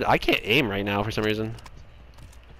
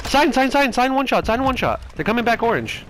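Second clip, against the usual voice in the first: urgent callouts; 12 semitones higher than usual. Real, not acted.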